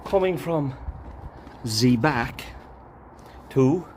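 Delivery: put-on coming from Z back to Z front in a jokey French accent; hammed-up French accent for a laugh; mock French accent. Gardener joking to his viewers in a mock French accent. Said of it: "That's my French accent here".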